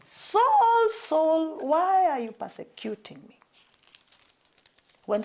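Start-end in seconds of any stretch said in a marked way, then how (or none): 0.0-3.4 heavenly voice calling. Acted. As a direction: call him from heaven, rising over the crowd. Voice from heaven calls Saul.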